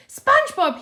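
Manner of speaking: high, loud startled yell. A narrator's reading. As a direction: shout his name in shock. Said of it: "he yelled".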